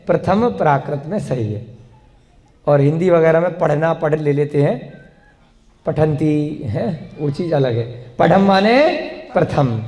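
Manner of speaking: ordinary talk, nothing marked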